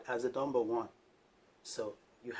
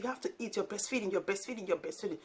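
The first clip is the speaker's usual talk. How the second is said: higher, pleading mom voice, repeating herself; mimicking mom; 7 semitones higher, faster than usual. Vlogger imitating her mother insisting she eat. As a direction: nag like an African mother fussing over you. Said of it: "She said".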